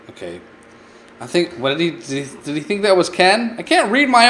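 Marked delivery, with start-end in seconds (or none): none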